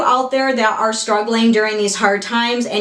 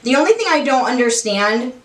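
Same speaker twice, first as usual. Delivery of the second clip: usual speaking voice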